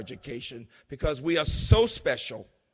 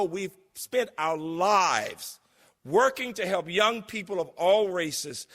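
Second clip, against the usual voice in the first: ordinary talk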